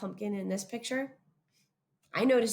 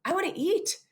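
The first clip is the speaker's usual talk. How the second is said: high, lightly voiced pleading pet; hungry pet voice, lightly played; voicing the hungry dog; 9 semitones higher, 3 dB louder than usual. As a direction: speak for the dog who wants dinner. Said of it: "That's what he was saying".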